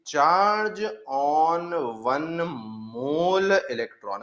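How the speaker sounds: very slow, drawn out dictation. Shown as a demonstration. Teacher dictating a definition to students, very slowly. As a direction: stretch every word so they can write. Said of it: "stretched dictation pace".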